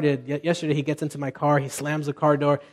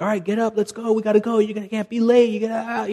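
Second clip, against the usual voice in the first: retold stern dad, higher and pushing, chasing a son out of bed; strict parent hurrying; 6 semitones higher than usual. Father rushing his late son to school, higher and insistent. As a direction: hurry him up like an impatient dad. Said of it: "my stance was like".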